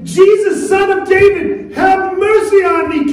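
prayer rising into a high shout; building cry for help. Acted. Parent praying for prodigal child and marriage, building cry. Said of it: "are you crying out to Jesus like that".

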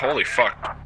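ordinary talk, nothing marked

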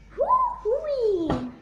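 high, loud, slow drawn-out whoop; wild whoop. Acted. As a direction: stretch it out like a giddy war cry. Giant chasing, roaring.

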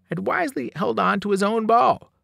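ordinary delivery, nothing marked

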